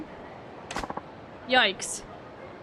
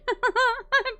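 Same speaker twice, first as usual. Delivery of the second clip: wild laugh; 11 semitones higher than usual. Real, not acted. Laughs at failed attempt.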